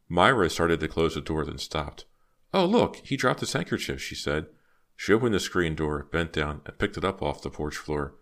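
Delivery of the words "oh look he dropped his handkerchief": high, lifted exclamation; bright-eyed noticing, pitched well up; surprised discovery. Acted. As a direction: point it out with sudden surprise. Girl spots a dropped handkerchief.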